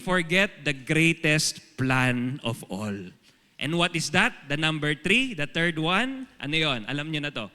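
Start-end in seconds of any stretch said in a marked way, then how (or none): none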